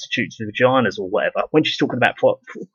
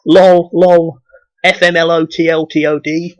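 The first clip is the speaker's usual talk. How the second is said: louder, higher sneering parody; mock text-speak; 5 semitones higher, 5 dB louder than usual. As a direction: spit the letters out like a scornful joke. Mocking text-speak addicts.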